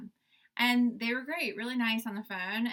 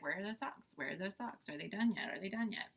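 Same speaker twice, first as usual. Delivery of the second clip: wondering aloud; 8 dB quieter than usual. Retold or imitated. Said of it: "we looked at each other".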